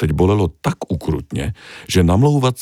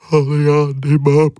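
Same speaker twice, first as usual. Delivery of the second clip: whimpering; 10 semitones higher, 5 dB louder than usual. Acted. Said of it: "fňukal Tony".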